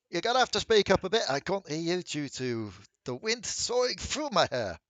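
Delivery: loud and fast over rushing wind; shouting over wind. Acted. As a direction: yell into the wind to be heard. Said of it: "hearing the whooshing of wind".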